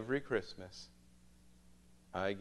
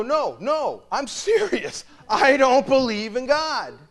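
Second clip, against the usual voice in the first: high, loud slow insistence; earnest student; 13 semitones higher, 16 dB louder, slower than usual. Retold or imitated. Student insisting to the priest, high and loud. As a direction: plead it like a frustrated teen. Student voiced pleading seriously.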